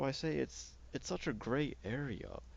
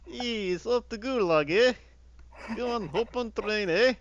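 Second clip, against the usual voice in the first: much higher, much louder hammed-up Russian Canadian accent; mock Russian Canadian accent; 12 semitones higher, 15 dB louder than usual. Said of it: "fucking Russian Canadian".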